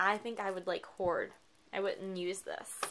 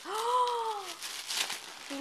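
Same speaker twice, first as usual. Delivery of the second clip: sharp gasp; 11 semitones higher than usual. Real, not acted. High gasp on opening Letty's package. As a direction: gasp as the gift is revealed.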